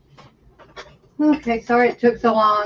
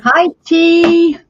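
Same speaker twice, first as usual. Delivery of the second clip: excited greeting; 8 semitones higher, 6 dB louder than usual. Real, not acted. Greeting friend joining stream.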